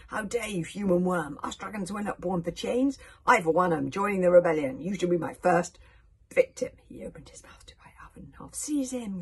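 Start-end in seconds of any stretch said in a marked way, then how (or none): 0.1-6.7 loud, furious dragon voice; big, forceful beast's threat, growing louder; roaring dragon. A narrator's reading. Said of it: "threw back his head and roared". 8.5-9.2 high, raised villain's command; urgent, half-whispered screech; screeched order. A narrator's reading. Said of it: "screeched out Alvin".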